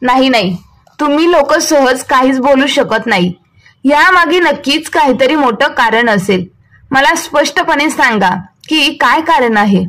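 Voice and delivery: ordinary delivery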